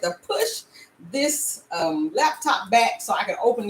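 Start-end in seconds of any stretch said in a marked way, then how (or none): none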